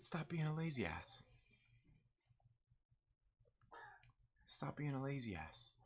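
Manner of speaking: mock menacing. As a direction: drop the volume for a quiet threat. Retold shirt-grabbing warning, spoken low.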